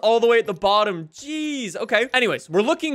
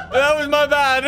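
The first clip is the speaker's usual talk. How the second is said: laughing apology; 2 semitones higher, 3 dB louder than usual. Real, not acted. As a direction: admit the mistake through laughter. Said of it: "I'm crying".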